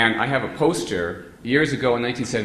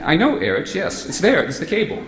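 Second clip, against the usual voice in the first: high, louder, impatient insistence; exasperated reply; 8 semitones higher, 5 dB louder than usual. Retold or imitated. Answering Erich's doubt, high and impatient. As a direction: point at the obvious, fed up.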